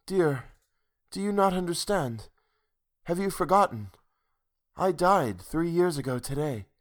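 wistful, otherworldly sweetness; gentle ghostly heroine. A narrator's reading. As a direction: break it to him tenderly, pitched high. Heroine gently reveals she is dead.

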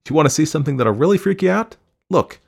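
fast, high, loud taunt; excited provocation; goading. A narrator's reading. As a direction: dare him to watch, voice way up. Wife goads husband.

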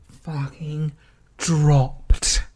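usual speaking voice

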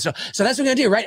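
mock hyper brother; hyper talker impression. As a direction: rattle on like an overexcited interviewee. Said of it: "It really does sound like this".

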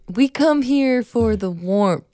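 slow, hammed-up Chicago accent. Teasing a co-host with a slow Chicago accent. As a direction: lay on a mock Midwestern drawl. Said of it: "Is this your Chicago accent?".

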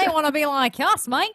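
high, hammed-up Australian accent; mock Australian accent. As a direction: tease with broad Australian vowels. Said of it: "suddenly become Australian".

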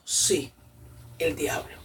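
low, soft confirmation; hushed answer. Retold or imitated. An inner voice confirms to her who is there, hushed.

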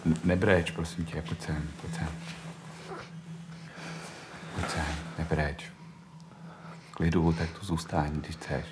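gentle soothing. Acted. Comforting a crying boy.

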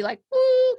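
high, loud steam-whistle woo; a sudden high cartoon steam hoot. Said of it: "if I was a cartoon character, like, steam would be".

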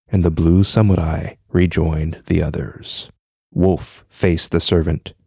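low, even storytelling voice; story narration. A narrator's reading. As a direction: read it like a spooky tale. Reads a ghost story aloud.

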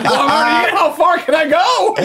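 high, loud, slow cartoon voice; hammed-up creepy little finger; mock finger voice. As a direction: squeak it out as the cheeky finger. Voicing the finger up the butt.

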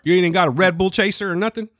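put-on Southern accent. Florida local asking about a chaser, Southern accent. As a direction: play a Floridian with a Southern twang. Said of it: "they have Southern accents in my head".

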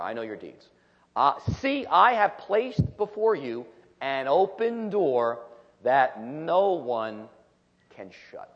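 slow, solemn reading of scripture. Acted. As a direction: read each phrase deliberately, with weight. Reads scripture aloud.

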